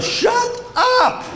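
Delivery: very high, strained comic outburst; exasperated professor. Retold or imitated. Professor stops the student's sob story, high exasperated outburst. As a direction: cut him off with a hammed-up squawk.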